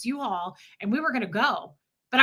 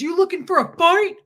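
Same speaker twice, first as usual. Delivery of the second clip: higher, louder mock challenge; mocking tough guy; 7 semitones higher, 7 dB louder than usual. Wife retells the neighbor's dare again. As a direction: puff up like a bully picking a fight. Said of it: "And he goes".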